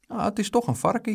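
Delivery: put-on stubborn little boy's protest; insisting. A narrator's reading. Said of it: "hield Dick vol".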